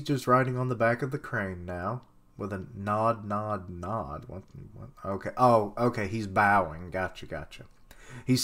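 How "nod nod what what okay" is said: normal talking voice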